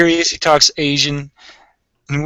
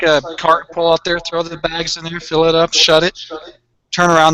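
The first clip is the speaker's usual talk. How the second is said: ordinary talk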